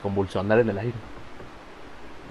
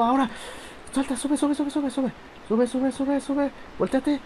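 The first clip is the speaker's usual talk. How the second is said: high, tense pleading; frantic urging; 12 semitones higher, slower than usual. Real, not acted. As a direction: urge it on in a panic. Urging the character across jump.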